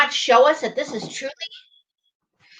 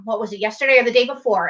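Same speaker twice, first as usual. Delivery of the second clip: ordinary delivery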